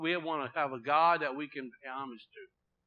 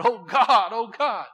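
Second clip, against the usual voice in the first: higher, loud mock desperate begging; mock pleading; 5 semitones higher, 9 dB louder, slower than usual. Preacher mocking a desperate pleader, loud mock begging. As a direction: ham up a frantic plea to God. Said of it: "yanking on the curtains and said".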